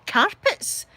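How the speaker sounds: mum's puzzled echo, acted out; baffled question. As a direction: query it in mock bafflement. Mum retold, baffled on phone.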